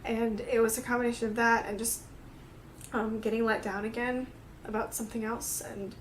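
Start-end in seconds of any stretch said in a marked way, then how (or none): none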